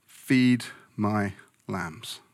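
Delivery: very slow, word by word; solemn charge. Acted. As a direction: entrust it slowly, with pauses between words. Jesus counsels Peter, acted.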